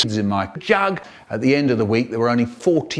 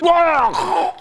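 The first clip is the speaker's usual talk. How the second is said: pleased whoa. Acted. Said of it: "Suddenly he looks pleased".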